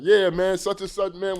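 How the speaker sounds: mock bragging. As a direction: boast loudly, mimicking a show-off. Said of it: "run around bragging and be like".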